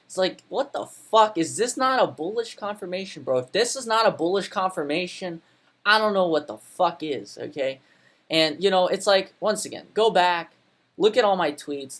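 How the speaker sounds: loud, high exasperated yelling; angry rant. Real, not acted. Trader furious that nobody sees his bullish confirmation, loud yelling. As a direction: vent your fury at the idiots.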